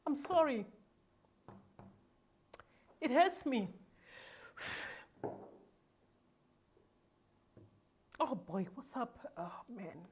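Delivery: real tears, barely getting the words out; emotional breakdown. Real, not acted. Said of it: "I'm gonna be emotional".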